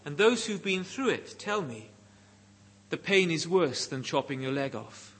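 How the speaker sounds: usual speaking voice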